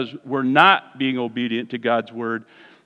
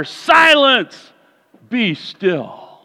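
rebuke; 6 semitones higher, 4 dB louder, much slower than usual. Retold or imitated. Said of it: "he rebuked the wind".

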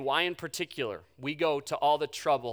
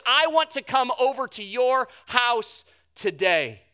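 loud, high call across a crowd; yelling out; 10 semitones higher, 10 dB louder than usual. Acted. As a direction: shout it out to someone far off. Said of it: "he yells out to Zacchaeus".

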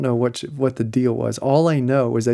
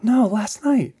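husband prompting; 9 semitones higher, slower than usual. Retold or imitated. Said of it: "and he says".

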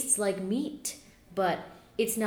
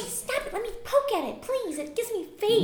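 fairy voice; 12 semitones higher than usual. Acted. Fairy Trisha poking teeth.

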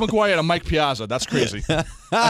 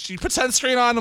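lightly imitated annoyed mom, higher pitched; nagging mother; 8 semitones higher than usual. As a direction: nag like a mother at her kid. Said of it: "My mother used to get pissed".

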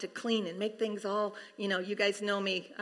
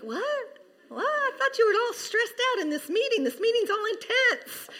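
much higher, baffled mock voice; puzzled coworker; 10 semitones higher than usual. Coworker asking the laughing worker, in a high baffled voice. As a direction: play the confused coworker.

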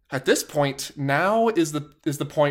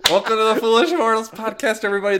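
big, bright podcast welcome, higher and louder; hyped intro; 7 semitones higher, 5 dB louder, faster than usual. Acted. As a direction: announce it like a show host.

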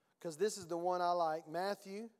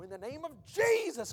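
imitated command bursting high and loud on Jesus; mock deliverance shout; worked-up exorcist; 5 semitones higher, 5 dB louder than usual. Mimicking someone commanding a demon out, worked up. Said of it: "you gotta work yourself up".

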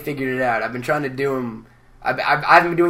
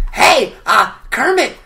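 much higher, louder, slow crackly weirdo; Gonzo impression; 12 semitones higher, 8 dB louder, slower than usual. Gonzo pitching a movie to Kermit, high and loud. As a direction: stretch it out like a dreamy oddball. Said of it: "The regular Gonzo is so much different".

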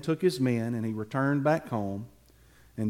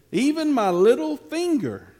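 little finger said much higher and louder; emphatic declaration; 11 semitones higher, 7 dB louder than usual. Shown as a demonstration. David vowing to his men, loud and emphatic. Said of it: "everybody show me your little finger".

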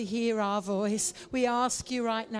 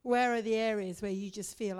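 usual speaking voice

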